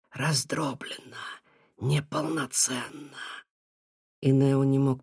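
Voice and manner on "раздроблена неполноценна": slow eerie whisper; otherworldly voice. A narrator's reading. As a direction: drag it out like a ghost. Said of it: "потусторонним голосом заговорил Нухим".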